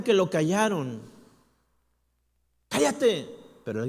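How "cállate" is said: loud, high "Cállate"; crowd's shout. Retold or imitated. The crowd silencing the blind man, loud. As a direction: snap it at him. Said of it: "muchos le gritaban".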